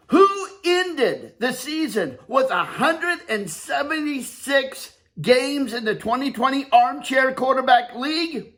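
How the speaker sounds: rising hype announcer build-up. Pretend. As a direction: build the crowd up before the call.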